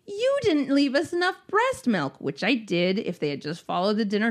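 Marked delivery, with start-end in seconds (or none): 0.1-2.2 high-pitched mock-wounded accusation; playing the victim. Grandmother blaming mother for the milk, mock victim. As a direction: imitate someone aggrieved, shifting the blame. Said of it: "Ever the victim".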